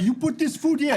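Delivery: mock accusing neighbor.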